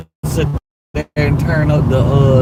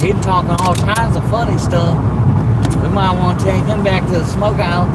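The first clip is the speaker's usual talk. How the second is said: put-on country accent; 5 semitones higher than usual. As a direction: lay on a thick country accent. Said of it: "You said you're gonna turn your country accent on?".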